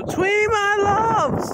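very high, loud drawn-out coo; squealed, stretched endearment; affectionate call. Real, not acted. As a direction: sing it out to the little loves. Calls out affectionately to named pets.

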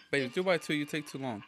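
usual speaking voice

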